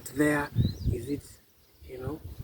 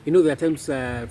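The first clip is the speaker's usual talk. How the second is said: normal talking voice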